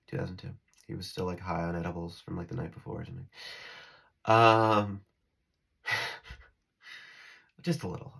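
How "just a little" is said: quick, hushed laughing aside; breathy laugh. Real, not acted. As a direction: chuckle it under your breath. Laughing aside about edibles.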